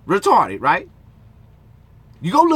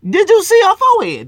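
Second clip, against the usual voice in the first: incredulous shout; 12 semitones higher, 10 dB louder than usual. Real, not acted. Mocking a girl's forehead.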